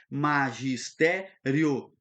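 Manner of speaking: "Magistério" drawn out in four slow beats; syllable by syllable. Shown as a demonstration. Teacher repeating the syllable separation, slow and split up.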